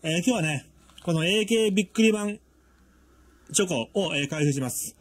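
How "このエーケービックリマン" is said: エーケービックリマン split apart, each sound spaced out; sounding out words. Shown as a demonstration. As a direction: say エーケービックリマン in separate chunks, not run together. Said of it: "なんでこんな区切って言ってんのかっていうと".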